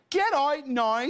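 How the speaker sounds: Belfast accent with a high lilt at the end; Irish accent. Shown as a demonstration. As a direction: demonstrate Irish, soaring up on "So it is". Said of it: "Oh, say this".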